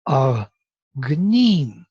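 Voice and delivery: very drawn-out "agnim", second syllable swooping up; pitch accent demo. Shown as a demonstration. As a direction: break "agnim" slowly, pausing after the low first syllable. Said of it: "this is how the pitch is being manipulated".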